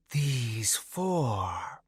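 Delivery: slow, breathy ghostly whisper; wraith whisper. A narrator's reading. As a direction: hush it out like a shadow wraith. Wraith answers the troll.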